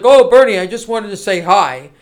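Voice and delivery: loud, eager "Oh, Bernie", then a timid hi; a school loser sucking up to the popular kid. Retold or imitated. Host mocking Steyer greeting Bernie like a school loser, loud then meek. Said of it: "he's like that loser guy in school".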